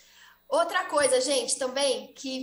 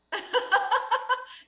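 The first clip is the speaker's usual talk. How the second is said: high bright burst of laughter; loud amused laughter; real laugh; 9 semitones higher, 5 dB louder than usual. Real, not acted. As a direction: laugh out loud at the silly word pair. Laughing at coco and cocô.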